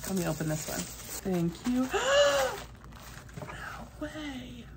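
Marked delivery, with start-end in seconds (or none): 1.9-2.6 high gasp of delight; surprised gasp. Real, not acted. Opening ears gift.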